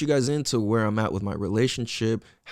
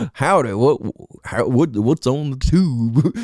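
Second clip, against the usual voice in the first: mock cowboy; 4 dB louder, slower than usual. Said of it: "however the fuck you talk".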